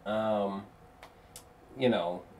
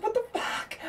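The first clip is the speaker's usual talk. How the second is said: high-pitched shocked squeak; incredulous gasp of a question; stunned disbelief; 6 dB quieter than usual. Retold or imitated. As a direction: react like you can't believe what you just saw. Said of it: "I was like".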